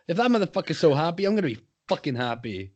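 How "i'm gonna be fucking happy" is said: ordinary talk, nothing marked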